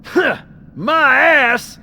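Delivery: drawn out, high, loud disbelief; slow incredulous snort; scoffing. Acted. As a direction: scoff at the obvious lie. Armorer scoffing at "classified.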